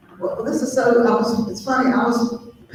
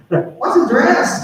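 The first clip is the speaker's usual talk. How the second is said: puzzled; 7 semitones higher, 3 dB louder, much slower than usual. Retold or imitated. Retold puzzled thought.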